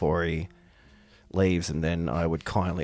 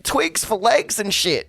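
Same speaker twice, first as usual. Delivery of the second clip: loud, high, brash barman voice; a rowdy pub barkeep ribbing a customer; gruff Aussie bartender; 13 semitones higher, 6 dB louder than usual. Acted. Bartender teasing the bard, loud and brash.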